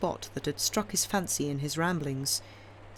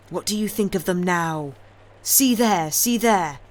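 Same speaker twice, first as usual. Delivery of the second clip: vengeful gloating; 3 semitones higher, 8 dB louder than usual. A narrator's reading. Avenger gloats at dying son.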